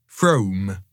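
broad Somerset burr on Frome; Somerset accent. Shown as a demonstration. Narrator obliging listeners on request, Somerset accent. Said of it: "using your best Somerset accent".

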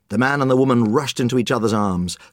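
ordinary delivery

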